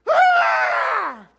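piercing high loud scream out of nowhere; sudden shriek. Retold or imitated. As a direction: shriek like the little sister. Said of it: "but she always screamed".

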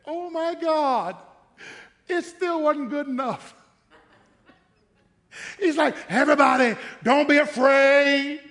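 loud, slow, high preaching voice; preaching at full force. Acted. Preacher firing up the church, loud and slow. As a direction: hammer each line out to the congregation.

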